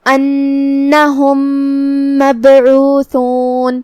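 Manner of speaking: انہم مبعوثون chanted very slowly, the two meems joined into one doubled meem; merged meem demo. Shown as a demonstration. Teacher shows the merged meem to students, slow chanted انہم مبعوثون. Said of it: "ایک ہی میم پڑھیں گے تشدید کے ساتھ".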